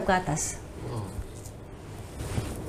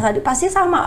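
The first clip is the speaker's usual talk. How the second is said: ordinary talk, nothing marked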